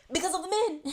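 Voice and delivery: high, loud punchline; exasperated outburst. Acted. Explaining why women need their own gym, exasperated outburst. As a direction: burst out with the obvious answer.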